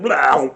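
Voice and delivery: imitating the big heave; loud imitated retch. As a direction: belt out a fake puke noise. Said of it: "that's exactly how it sounded".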